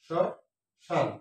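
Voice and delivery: শ্মশান as 'shɔ... shan', silent m; syllable by syllable. Shown as a demonstration. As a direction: slowly break শ্মশান into two sh syllables. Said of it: "উচ্চারণ হচ্ছে তালব্য স". (শ... শান, ধীরে ভেঙে)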